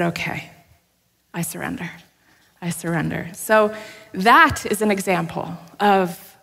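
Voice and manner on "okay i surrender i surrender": quiet surrender. Retold or imitated. Retold surrender prayer.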